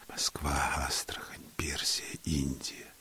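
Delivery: hushed, near-whispered list of places; inward thought. A narrator's reading. Lover breathing her scent and thinking, hushed. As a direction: let each name drift out softly. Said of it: "думая".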